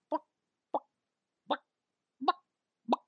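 short, soft, spaced-out clucks; lone little buck, then a pause; imitated chicken clucks. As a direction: cluck sparsely like a ghost chicken. Imitating a chicken heard at night.

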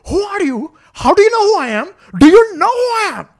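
high, building to a loud squawk; hammed-up, escalating indignation. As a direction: imitate an outraged big shot with an Indian accent. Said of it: "raising his voice a lot".

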